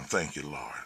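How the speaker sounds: low, very soft thanks; thanking the Lord. Retold or imitated. Driver relieved to deliver her safely, softly thanking the Lord. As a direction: breathe out a quiet prayer of relief. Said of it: "thanking the Lord".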